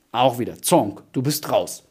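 usual speaking voice

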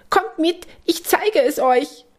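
very high mouse voice calling out; Lilli. A narrator's reading. Church mouse urging the friends to follow, very high call. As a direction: call them along in a high little voice. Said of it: "ruft Lilli".

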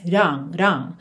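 "wrong" with a light tapped first consonant and no final T; "wrong" as don't reduced to "ron". Shown as a demonstration. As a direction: soften the start of "wrong" into a quick tap. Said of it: "essa redução aí de T e D".

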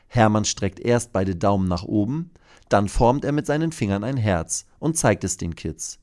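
usual speaking voice

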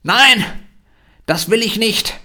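put-on loud, high-pitched stubborn child refusing; bockiges Kind. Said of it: "das Kind wird laut ... So richtig bockig". (lautes, bockiges Kind)

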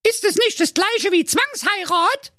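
sehr hohe, schnippische Mädchenstimme; verwöhnte Prinzessin. Acted. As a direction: zickige Teenie-Prinzessin. Prinzessin fragt. (die Prinzessin lehnt den Freier spöttisch ab)

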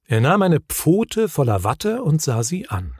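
plain reading voice

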